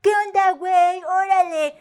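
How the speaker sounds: put-on high, overdone Mexican accent; mocking Mexican accent. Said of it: "why do they use these three words?".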